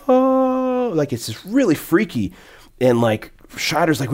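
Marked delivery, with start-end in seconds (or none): 0.1-0.9 high, ghostly wail imitating a weird movie sound; eerie sound effect. Podcaster imitates a creepy movie sound effect, high eerie moan. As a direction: moan it like something freaky from The Outer Limits. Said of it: "it's like this".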